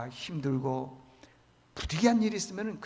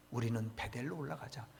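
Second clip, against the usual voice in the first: quiet; 5 semitones lower, 12 dB quieter than usual. Shown as a demonstration. Paraphrase before reading the verse.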